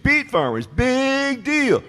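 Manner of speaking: high, sneering heckler voice; mock them from the crowd; sing-song put-down; mocking heckle. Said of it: "she kept doing it".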